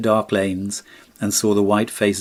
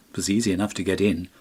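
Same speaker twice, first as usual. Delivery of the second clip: plain reading voice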